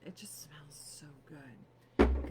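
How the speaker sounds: slow dreamy whisper. Real, not acted. Praising the cleansing balm, slow whisper. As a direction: breathe it slowly like a secret.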